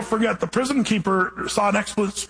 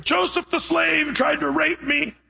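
mock yell; 10 semitones higher, slower than usual. Potiphar's wife accusing Joseph, high-pitched mock yell. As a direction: ham up her false accusation, voice jumping high. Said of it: "and she yells out".

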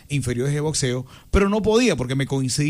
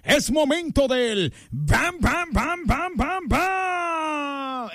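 lucha libre announcer; 8 semitones higher, much slower than usual. Acted. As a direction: announce it to the arena. His battle cry again.

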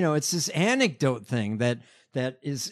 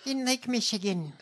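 mocking politician impression; 7 semitones higher than usual. Co-host parodies politician's constituent story, high mocking voice. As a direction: mimic her folksy anecdote in raised pitch. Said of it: "she said".

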